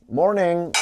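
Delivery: put-on loud, high American-accented greeting; attempted American accent. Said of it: "I even got to say".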